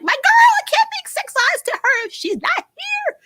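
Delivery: very high mock crying voice; mocking Lena. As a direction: whine it out way up high like a lovesick girl. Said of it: "she's like".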